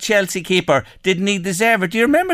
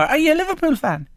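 Liverpool fan said with a high rising lilt; voice going up; 12 semitones higher than usual. Real, not acted. Presenter teasing guest, question rising high with doubt. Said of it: "Your voice went up at the end of that sentence".

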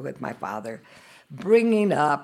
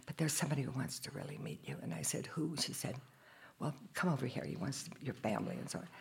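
soft quiet voice; 12 dB quieter, faster than usual. Retold or imitated.

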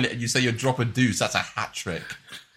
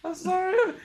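very high, soft squeaky whine; mimicking guilty dog voice; 6 dB quieter than usual. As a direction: copy the shamed puppy. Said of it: "when you do that shit".

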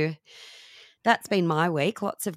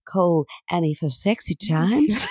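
sultry hotline voice. Pretend. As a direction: purr it like a hotline operator. Joke about a sexy hotline.

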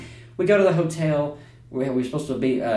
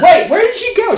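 much higher, louder baffled question; mock surprise; 9 dB louder than usual. Losing the Russian woman in the crowd, high mock surprise. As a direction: act lost and puzzled. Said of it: "I was like".

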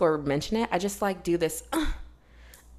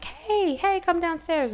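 mom calling up; 12 semitones higher, much slower than usual. Retold or imitated. Mom calling kid from downstairs.

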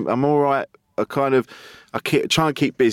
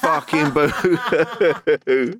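mocking boo-hoo; 7 semitones higher, much slower than usual. Partner mocking her complaint in couples counselling, slow sneering boo-hoo. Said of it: "Jade went".

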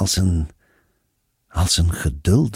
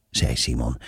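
ordinary delivery

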